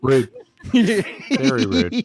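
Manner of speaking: high, slow, drawn-out mock offense; mock offended. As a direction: stretch it out, pretend to be hurt.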